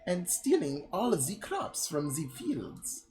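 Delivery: mock French accent with swooping pitch. Villager asking for help against raiders, hammed-up French accent. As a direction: plead in a silly French accent. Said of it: "my voice just goes from French stereotype".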